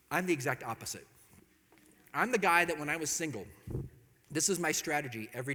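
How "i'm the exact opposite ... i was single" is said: ordinary delivery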